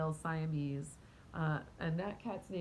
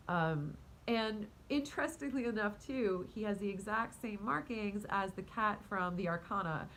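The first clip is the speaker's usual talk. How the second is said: ordinary talk, nothing marked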